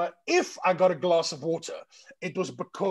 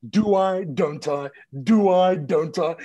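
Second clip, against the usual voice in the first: acted dithering; 8 dB louder, slower than usual. Debating whether to snap back at her, loud see-saw dithering. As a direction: bounce loudly between the two options.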